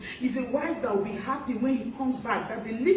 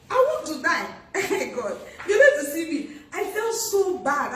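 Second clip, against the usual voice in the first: louder and higher, laughing through the words; 5 semitones higher, 4 dB louder than usual. Real, not acted. Wife retelling her birthday letdown to the congregation, laughing loudly. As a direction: laugh at the memory of your own embarrassment.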